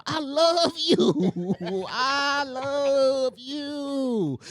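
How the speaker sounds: very drawn-out, high mock wail; retold desperate yelling. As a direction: stretch each word into a hammed-up plea. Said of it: "And I start yelling".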